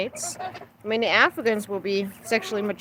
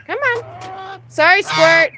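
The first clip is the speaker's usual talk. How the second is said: usual speaking voice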